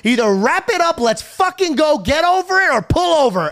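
high, loud exasperated yelling; angry outburst. Retold or imitated. Friend telling George to stay out of it, shouting angrily. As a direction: build into a fed-up shouted rant.

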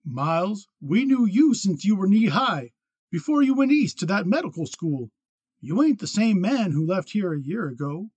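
hurried appeal. A narrator's reading. Old acquaintance pleads with changed man, hurried appeal. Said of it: "Shorty added quickly".

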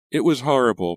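trembling cry. Acted. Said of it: "Pam cried in a trembling voice".

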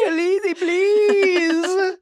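mock crying knight pleading with his queen; weak pleading Jorah. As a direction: beg like a broken man near tears. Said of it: "very weakly here says".

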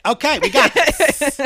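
high, loud, drawn-out reassurance; mock lawyer confidence. Lawyer reacting to delusional client, high-pitched mock confidence.

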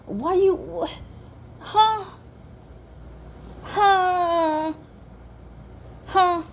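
high, stunned what and wait; shrill-free but high incredulous huh; outraged disbelief. Retold or imitated. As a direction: sputter in high-pitched disbelief. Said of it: "I'm like".

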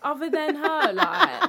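loud burst of laughter; laughing. Real, not acted. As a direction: laugh through the words. Laughing at cousin joke.

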